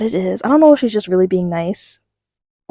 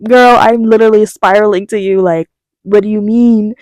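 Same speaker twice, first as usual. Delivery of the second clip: louder panicked inner voice; 9 dB louder than usual. Retold or imitated. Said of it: "in my head".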